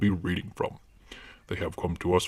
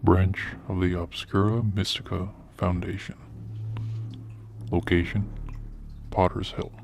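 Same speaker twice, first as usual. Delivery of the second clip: ominous narration; 5 dB quieter than usual. Acted. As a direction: announce the dark mission. Ominous foundation intro narration.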